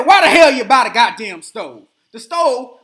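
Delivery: loud furious demand about money; angry yell. Retold or imitated. Husband blowing up at his wife over a stove, furious shout.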